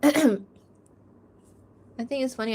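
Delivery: usual speaking voice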